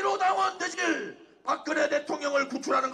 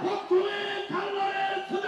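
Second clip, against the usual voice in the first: slow shouted slogan; roaring rallying speech; 2 semitones higher than usual. Real, not acted. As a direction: chant it for the crowd to repeat. Leader chants slogan for crowd.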